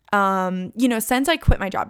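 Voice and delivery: ordinary talk, nothing marked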